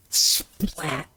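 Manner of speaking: high, soft splat sound effect; mock splat noise. Man quoting Belushi at the preppies' table, a soft high splat. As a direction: spit out a wet splat. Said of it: "And he goes".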